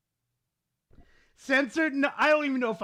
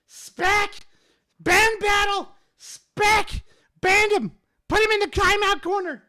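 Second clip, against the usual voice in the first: much higher and louder, yelling for a ban; calling out loudly; 11 semitones higher, 7 dB louder than usual. Real, not acted. Streamer yelling at his mod to ban a chatter, shouting. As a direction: shout across the room for him to ban someone.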